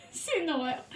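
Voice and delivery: squeaky high laugh of despair. Real, not acted. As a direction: laugh it out, exasperated. Laughs at the failed retakes.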